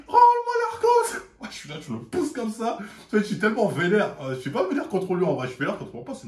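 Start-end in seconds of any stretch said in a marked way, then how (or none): 0.1-1.2 shrill, much higher, slow laughing yell; mock shriek of the cheated boy; outraged shriek. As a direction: shriek it like a kid robbed, hammed up. Boy grabs him, demands Arcos.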